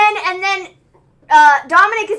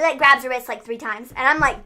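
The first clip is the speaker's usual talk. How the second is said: ordinary talk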